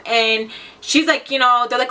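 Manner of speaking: usual speaking voice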